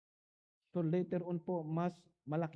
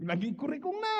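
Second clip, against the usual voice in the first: laughing; 8 semitones higher, 6 dB louder than usual. Real, not acted. Laughs telling about his ear.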